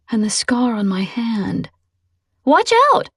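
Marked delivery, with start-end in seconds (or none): 2.5-3.1 sudden high, loud yelp. Acted. Passenger yelping as the car drifts across the road. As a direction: yelp in alarm at swerving car. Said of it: "Angela yelped".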